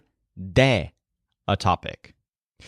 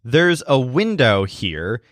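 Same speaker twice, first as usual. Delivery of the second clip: ordinary delivery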